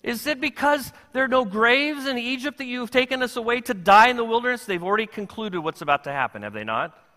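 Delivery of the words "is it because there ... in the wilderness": high, loud panicked cry to Moses; terrified Israelites. Retold or imitated. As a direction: plead like a crowd sure it is about to die. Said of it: "They say to Moses".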